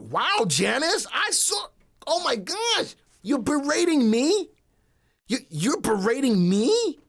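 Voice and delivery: very loud, high-pitched disbelief; playfully aghast, voice shooting way up; mock outrage. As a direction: yelp it in hammed-up shock. Mock shock at being berated.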